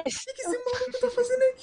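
high laughing surprise. Real, not acted. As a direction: laugh out the question. Surprised guest joining.

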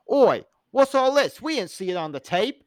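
loud mock "Oi" cockney copper; British copper. BBC programs director catching thieves, mock cockney copper shout. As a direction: bellow it like a London bobby. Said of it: "the B B C programs director is like".

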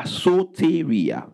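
Soteria spoken slowly, so-te-ri-a, each syllable clear; Greek word said slowly. Shown as a demonstration. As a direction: spell it out by sound, Soteria. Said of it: "from the Greek word soteria".

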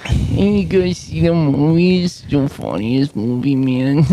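drawn-out, hammed-up stoner talk. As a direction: play an amused stoner, stretching the words. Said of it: "That's how you talk when you watch a stoner movie".

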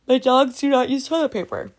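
words stretched through a real yawn. Real, not acted. Vlogger to camera about her dogs, mid-yawn. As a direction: say it mid-yawn, mouth wide. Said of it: "Sorry, I was yawning there".

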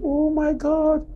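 put-on high, slow astonished cry of an old aunt; shouted surprise. Old aunt recognising her former guest: high surprised cry. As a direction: let it out in amazement, stretched. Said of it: "she shouted".

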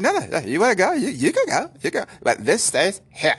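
high sing-song threat. Retold or imitated.

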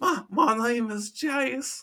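put-on slow, high, giggly kid voice. Co-host mimicking his own impression, slow and giggly. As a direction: drag it out like a toddler. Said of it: "you sound like a redneck four-year-old".

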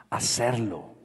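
usual speaking voice

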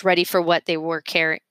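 ordinary delivery, nothing marked

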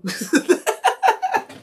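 a squeaky, very high burst of laughter. Real, not acted. Streamer laughing at his own imagined reply, very high. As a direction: crack up at your own joke, high.

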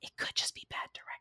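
quick, voiceless whisper; whispered aside. Real, not acted. Suggesting to co-hosts who's to blame, whispered aside. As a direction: slip it in under your breath, fast.

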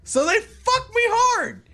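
very high, loud exasperated cry; exasperated outburst. Real, not acted. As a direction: cry out in comic outrage. Exasperated rant peak.

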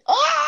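high-pitched mock scream for help; imitated yell. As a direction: yell out like the trapped friend. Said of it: "I hear this man Hayden yell".

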